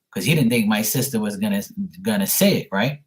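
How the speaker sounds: normal talking voice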